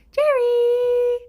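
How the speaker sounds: high, loud, drawn-out call; calling a pet. Real, not acted.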